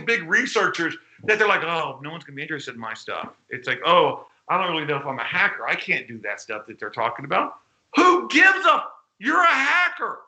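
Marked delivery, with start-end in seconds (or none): 1.6-3.3 low, quick, softer dejected newcomer voice; mock self-doubt. Voicing overwhelmed newcomers' self-doubt. 3.8-7.6 lightly voiced discouraged newcomer, pitched lower; mock self-doubt. Voicing overwhelmed newcomers' self-doubt. 7.9-10.2 high, loud, emphatic rebuttal; exasperated outburst. Real, not acted. Exasperated answer to the self-doubters.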